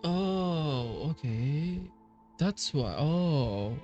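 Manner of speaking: ordinary delivery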